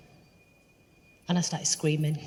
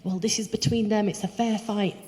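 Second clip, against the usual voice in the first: higher-pitched voice of a defensive mother; acted-out quarrel, pitched up; imitating arguing mother; 5 semitones higher than usual. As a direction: argue back as the other woman.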